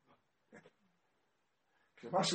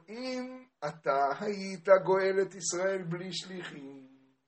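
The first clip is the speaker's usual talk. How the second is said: slow, drawn-out chanted plea, higher; sing-song preaching; 4 semitones higher, 3 dB louder, slower than usual. Retold or imitated. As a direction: recite it in a sustained learning chant. (ניגון לימוד איטי ומתמשך)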